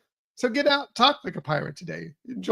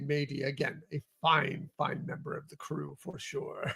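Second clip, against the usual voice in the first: lower, softer mock pirate praise; 4 semitones lower, 10 dB quieter than usual. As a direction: slip back into a hammy pirate, quietly. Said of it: "not gonna do this the whole, the whole time".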